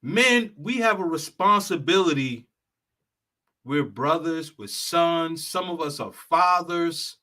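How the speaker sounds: ordinary talk, nothing marked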